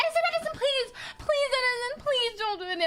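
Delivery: very high, near-crying retold plea; acted panic, shrill and tearful; panicked pleading. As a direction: beg as your voice hits panic levels. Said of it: "my voice, like, starts hitting panic levels".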